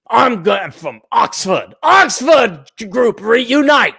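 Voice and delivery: hammed-up British rallying call; snooty British. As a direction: draw it out like a snooty British officer. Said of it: "they'd be all snooty and British".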